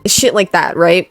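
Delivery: normal talking voice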